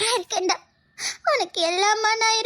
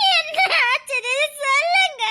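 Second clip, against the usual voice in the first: higher, slower, pressing for an answer; urgent tearful plea; 6 semitones higher than usual. Acted. To the doctor, demanding an answer through tears. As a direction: push the doctor to answer, crying.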